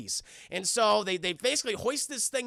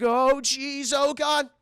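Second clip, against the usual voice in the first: mock fright; 4 semitones higher, 5 dB louder, much slower than usual. Woman dangling over the drop.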